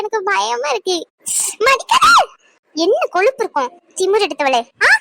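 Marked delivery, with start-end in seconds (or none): 1.7-4.9 angry shout. Acted. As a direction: shout her down furiously. Mother scolding daughter. (மகளைத் திட்டும் கோபக் கத்தல்)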